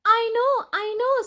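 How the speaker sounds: high, loud eager blurting; eager kitten. Acted. Kitten eagerly offers her idea, high and loud. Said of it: "said Kitten".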